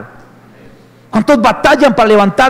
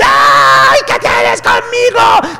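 demon screech, high, slow, drawn out; hammed-up possessed cry; demon shriek; 8 semitones higher, much slower than usual. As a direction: shriek it like a possessed man cornered. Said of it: "Jesús estaba predicando".

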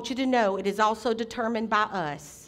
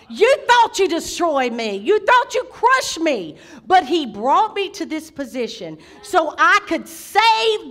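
high, punched key words; full-force preaching; 5 semitones higher, 7 dB louder than usual. Real, not acted. Preacher drives home God's purpose to the congregation, forceful preaching. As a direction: build with rolling, emphatic force.

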